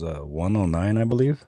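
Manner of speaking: usual speaking voice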